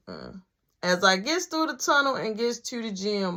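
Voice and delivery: plain reading voice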